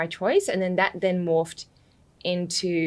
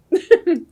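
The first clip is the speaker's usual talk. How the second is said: short laugh; 12 semitones higher, 8 dB louder than usual. Real, not acted. Laughing at being the odd one.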